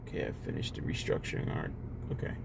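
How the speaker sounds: very soft, unvoiced murmur; muttered aside. Real, not acted. Speaker breaks off for an aside about restructuring, muttered softly. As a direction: murmur it almost under the breath.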